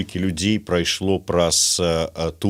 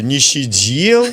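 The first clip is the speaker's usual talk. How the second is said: louder, higher, drawn-out mock retort; mock dismissal; 4 semitones higher, 4 dB louder, slower than usual. Host parodies a prison-minded put-down, mock dismissal. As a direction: stretch it into a jokey put-down. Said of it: "тут будет".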